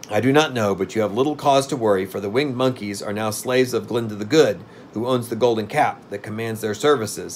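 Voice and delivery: ordinary delivery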